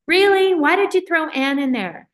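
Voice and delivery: high, loud mock disbelief at mom; incredulous protest. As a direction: play the exasperated kid asking mom why. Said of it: "And I'm like".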